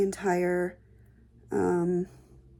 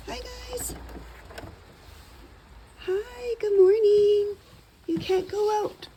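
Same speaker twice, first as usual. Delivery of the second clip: pet talk voice; 13 semitones higher, 6 dB louder, slower than usual. Real, not acted. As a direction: coo at the pets, way up high. Greeting her pet rabbits and pigs.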